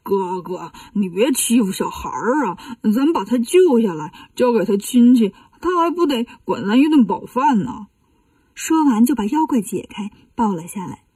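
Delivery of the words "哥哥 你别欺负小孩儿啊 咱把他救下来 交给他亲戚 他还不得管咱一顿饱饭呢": 八戒, the pleading pig. Acted. 八戒 asks his brother to spare the child, put-on pleading voice. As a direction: plead like an easygoing glutton.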